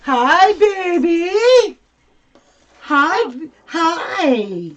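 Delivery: high, loud cooing greeting. Real, not acted. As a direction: squeal hello to a puppy. Greeting the puppy excitedly.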